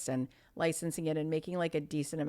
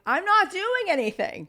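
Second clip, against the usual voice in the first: much higher, louder innocent insistence; gleeful protest; 12 semitones higher, 8 dB louder than usual. Retold or imitated. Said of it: "I'm like".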